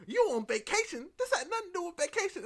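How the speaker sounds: loud, high, incredulous challenge; mock indignation. Kat challenging Doki's vacation excuse, loud high mock indignation. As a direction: throw the excuse back, hammed-up.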